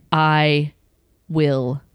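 low, slow mock threat, a hint of menace; retold fury. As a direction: growl the threat low and slow, half in jest. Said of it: "I was so angry".

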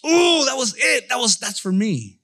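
thrilled it's meant for you; excited discovery. Acted. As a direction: burst out like you just found it. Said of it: "You're like".

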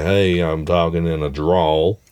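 slow, stretched Southern drawl. Shown as a demonstration. Explaining drawls to a child, slow Southern drawl. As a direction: demonstrate a Southern accent, vowels drawn out. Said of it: "A southern drawl is kinda like".

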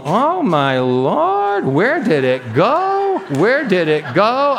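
high, drawn-out mock wail of amazement; astonished wailing. Preacher acts out the healed woman over there, astonished wailing. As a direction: imitate a stunned woman crying out, stretching the words. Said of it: "And she's screaming".

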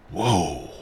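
ordinary talk, nothing marked

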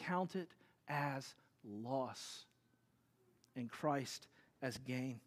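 quiet, slow reading of scripture; soft recitation. Acted. Preacher gently reciting Paul's words to the congregation, quiet and slow. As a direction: let the verse settle softly, slowly.

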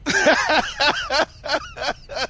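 high-pitched real laugh. Real, not acted. Co-host reacting to the mention of Dabo, laughing. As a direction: burst into a long, high laugh.

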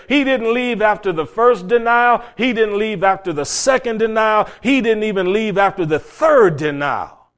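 high, loud, hammering repetition; full-force preaching. Real, not acted. Preacher driving home that he stayed after each denial, loud and insistent.

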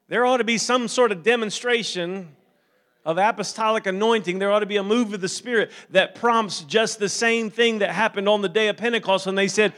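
loud, driving pulpit delivery; impassioned preaching. Real, not acted. Preacher calling the church to expect God's power, impassioned.

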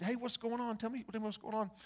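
soft, quiet coaxing of a hurting friend; gentle comforting. Retold or imitated. Retelling how he softly asks a crying classmate what's wrong. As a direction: ease the question out gently.

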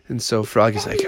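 ordinary delivery

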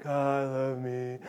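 very low, soft, slow drone; mock half-dead congregation mumble; dead, lifeless. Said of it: "Like, we're just dead".

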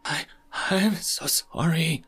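slow, high, broken apology; mild acted sobbing from Mola. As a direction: sob it out like an alien in tears. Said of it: "sobbed Mola".

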